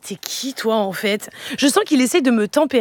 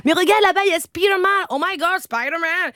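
excited; 6 semitones higher than usual. Retold or imitated. Excited mother at Disney.

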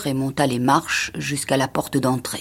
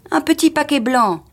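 high, louder, falling at the end; insistent statement; 10 semitones higher, 5 dB louder than usual. Acted. Husband describing the lost gift to his wife, insistent. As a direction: spell it out emphatically.

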